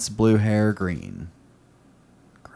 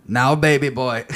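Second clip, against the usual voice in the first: mock Scottish ogre croon; Shrek voice. Reading Shrek's line in a hammed Shrek voice. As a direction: lean into the ogre accent. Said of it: "in the accent".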